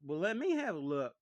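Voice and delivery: very loud, pushy claim; second sister loudly demanding a look; eager sister. Acted. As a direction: grab first dibs.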